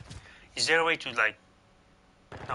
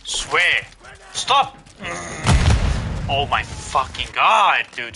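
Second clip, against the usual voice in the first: raging gamer; 3 semitones higher, 6 dB louder, much slower than usual. Real, not acted. Raging at lag.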